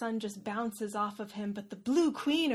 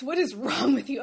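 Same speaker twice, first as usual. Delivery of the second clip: mock outrage; 3 semitones higher, 8 dB louder than usual. Dragon snaps at girl.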